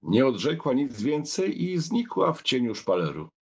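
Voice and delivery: usual speaking voice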